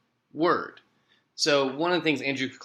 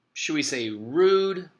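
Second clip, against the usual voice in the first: ordinary delivery, nothing marked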